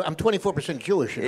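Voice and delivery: ordinary delivery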